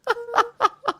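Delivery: high breathy laugh; high giggle. Real, not acted. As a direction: break into a giggle. Laughs at own voice.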